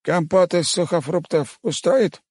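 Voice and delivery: put-on high, slow old man voice; old cook voice. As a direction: ask slowly like an old cook. Cook offers drink.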